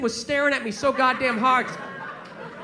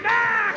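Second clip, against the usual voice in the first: battle cry; 7 semitones higher than usual. Retold or imitated. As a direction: charge with a yell. Dog attacks, battle cry.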